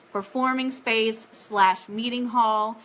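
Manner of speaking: usual speaking voice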